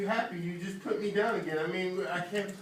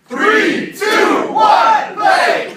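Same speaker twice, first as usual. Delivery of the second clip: high, loud countdown yell; countdown shout; 20 dB louder, much slower than usual. Real, not acted. Countdown to start the game.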